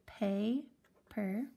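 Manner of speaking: pay, per as two beats; teacher splitting paper; split syllables. Shown as a demonstration. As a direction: say pay then per apart. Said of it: "paper has two syllables".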